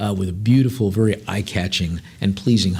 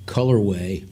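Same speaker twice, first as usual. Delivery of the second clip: normal talking voice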